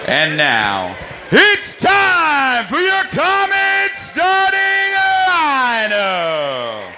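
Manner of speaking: sung-out rising home-team intro; hyped arena announcer. Acted. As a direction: build it up for the home crowd. PA hypes home lineup.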